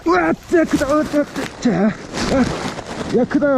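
loud, high thrilled yelling; excited shout. Real, not acted. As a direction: shout out over a huge catch. Big catch, excited shouting.

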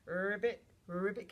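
loud ribbit with rolled start; punchy frog imitation; frog croak. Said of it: "Can you make that sound".

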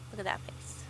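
soft breathy whisper. Shown as a demonstration. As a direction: hush it to a whisper. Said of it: "repeats phrase as whisper".

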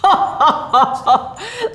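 high, rolling ho-ho laugh; jolly storybook belly laugh; Santa's laugh. A narrator's reading. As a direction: laugh heartily in a string of hoots. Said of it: "Laughed Santa Claus".

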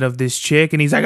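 ordinary delivery

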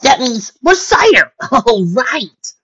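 loud, high, stretched-out delight; whooping pony excitement; excited cheer. Acted. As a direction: burst out laughing with glee. Rainbow cheers about more cider.

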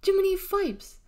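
high, loud exclamation; joyful holler. A narrator's reading. Deli's happy part wants to cheer, hollering. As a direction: burst out with delight. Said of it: "wanted to holler".

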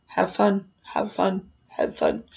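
soft, lifeless monotone; dead fish. Retold or imitated. Ride worker after hours of greeting guests, lifeless like a dead fish. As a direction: say it drained, fading away to almost nothing. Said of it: "It was like a dead fish".

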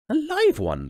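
high loud insistence; high loud queenly clarification; queen insisting. Acted. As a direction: insist like a firm old queen.

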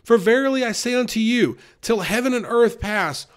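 ordinary delivery

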